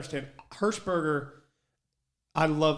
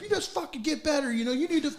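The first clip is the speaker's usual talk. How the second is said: imitated coach's retort, pitched high; 10 semitones higher than usual. Player imitating coach telling him to get better, high-pitched retort. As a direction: argue back as the coach, voice raised in pitch.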